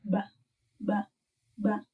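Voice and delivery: ba with a soft, unblown B. Shown as a demonstration. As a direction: keep the lips soft on each ba. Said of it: "Make it soft".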